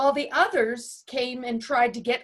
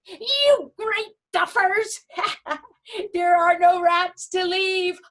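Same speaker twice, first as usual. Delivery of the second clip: higher, louder gloating yell with laughs; shouting; 6 semitones higher, 4 dB louder than usual. A narrator's reading. Dog jeering at the pirates across the water, loud and laughing. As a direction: crow at them across the water. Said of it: "Jip shouted across from the other ship".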